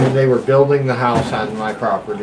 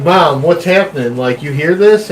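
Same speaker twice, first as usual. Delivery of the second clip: louder, urgent call to mom; 3 semitones higher, 5 dB louder than usual. Retold or imitated. Kid telling his mom about the noise, a louder urgent call. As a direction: call across the room as a scared kid.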